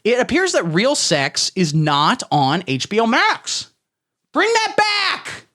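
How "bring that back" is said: much higher, slow whiny plea; mock pleading. Begging the streaming service to return the show, high and whiny. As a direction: stretch it out like a begging child.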